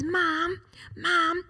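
lightly voiced child, pitched high; young son calling mum. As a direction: imitate a son calling for his mother. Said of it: "walking home from school with Jaden".

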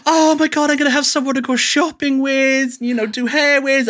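hammed-up high-pitched overjoyed gushing; mock tearful happiness. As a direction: imitate an overjoyed sister gushing through happy tears. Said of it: "crying with literal happiness".

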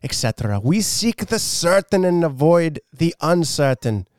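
slow amateur Scottish reading; Scottish accent. Shown as a demonstration. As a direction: draw out the words in an attempted Scottish accent. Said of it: "can you read this in a Scottish accent".

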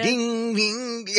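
high sung bell imitation; ding ding chime.

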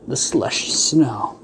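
ordinary talk

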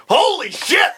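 high, shouted amazement; stunned mock outcry; astonished Congress. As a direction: gasp it out in loud, high awe.

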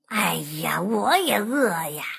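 low, soft groaning complaint; weary fox. A narrator's reading. Hungry fox answers the duckling, groaning low. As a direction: grumble low, as a worn-out fox.

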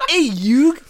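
mock Brooklyn accent, laughing; lightly hammed-up Brooklyn accent. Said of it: "really deep Brooklyn accent".

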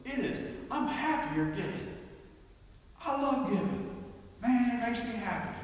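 put-on high, laughing old man's voice; happy old man. Old man telling the preacher giving makes him happy, high and laughing. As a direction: beam with joy as the old giver. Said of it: "He told me this".

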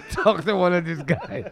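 laughing through the words; breaking into real laughter; laughing punchline. Real, not acted. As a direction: crack up as you deliver the punchline. Breaks up at his own punchline.

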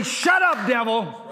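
high, loud, bold command; bold rebuke. Shown as a demonstration. Preacher showing believers how to rebuke the devil, bold shout. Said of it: "We need to get bold sometime and say".

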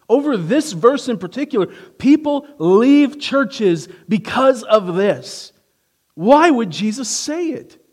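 loud, high, climbing preacher's cry; preaching at full force. Acted. Preacher to the congregation, rising to a loud cry. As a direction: drive the question home to the congregation.

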